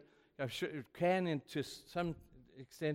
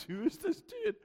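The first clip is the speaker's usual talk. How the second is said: much higher, a startled squeak of confusion; baffled high squeak. Retold or imitated. A young pastor baffled by a stranger's call, squeaking high. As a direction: squeak it out like you can't believe it. His own reaction to the call.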